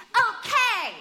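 a bright cheerleader shout. Acted. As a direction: call it out like cheer practice. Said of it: "I was a cheerleader".

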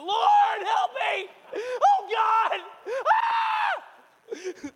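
high, hammed-up panicked pleading; imitated screaming. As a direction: wail to God like a panicking wife, ending in a shriek. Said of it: "She's screaming".

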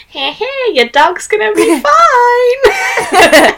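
high, drawn-out, gleeful little menace; tiny little psychopath. Acted. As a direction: squeal it slowly like a pint-sized psycho. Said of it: "tiny little psychopath".

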